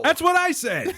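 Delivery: high squeaky mock outburst; mock excited. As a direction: pitch it way up, hammed-up. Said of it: "I'm like".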